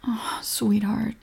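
slow, soft whisper of comfort; tender whisper. Acted. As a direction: breathe it gently to soothe him. Comforting upset partner.

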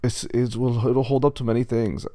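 put-on German-accented pig. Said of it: "but he's German".

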